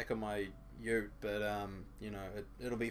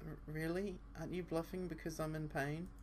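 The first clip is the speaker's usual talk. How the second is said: put-on higher girl voice, whimpering; pained girl voice; 6 semitones higher, 4 dB quieter than usual. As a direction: play her hurting and scared. Asa doubtful.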